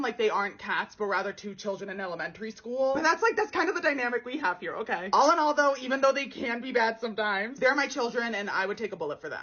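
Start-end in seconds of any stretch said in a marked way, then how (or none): none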